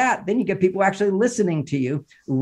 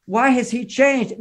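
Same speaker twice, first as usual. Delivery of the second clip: higher, slow, wounded question; mock plaintive wife; 7 semitones higher, slower than usual. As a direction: imitate a puzzled complaining wife, drawn out. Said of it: "Women are always saying to me".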